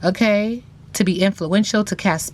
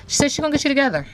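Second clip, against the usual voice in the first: fast; 7 semitones higher, much faster than usual. Real, not acted. Relaying a card's threat.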